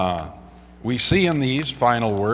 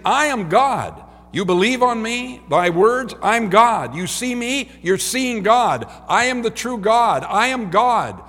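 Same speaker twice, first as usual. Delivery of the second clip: high, forceful declaration, hammering each phrase; crying out; 10 semitones higher, 6 dB louder than usual. Acted. Jesus declares himself God to the crowd, crying out. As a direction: proclaim it loudly as a last plea. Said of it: "he's crying out".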